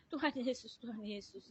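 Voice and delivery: soft imitation of a weak plea; retold tearful calling. Patient crying to Jesus at midnight, imitated fading weeping call. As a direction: repeat it quietly, fading, as a mimicked weeper. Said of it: "Tengah malam dia nangis".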